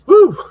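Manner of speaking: big loud breathy whew; loud exhale. Real, not acted. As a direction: blow out a tired whew. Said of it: "catch my breath".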